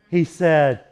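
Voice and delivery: normal talking voice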